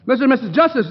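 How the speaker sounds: high, loud startled repeat; horrified double take at the names; alarmed. Acted. Hero dreads visiting couple.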